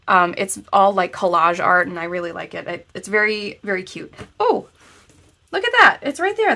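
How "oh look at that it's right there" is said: high excited surprise; sudden delighted discovery; surprised. Real, not acted. Spots the code suddenly.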